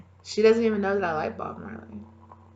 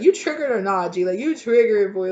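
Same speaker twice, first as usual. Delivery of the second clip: mock taunt; 9 dB louder than usual.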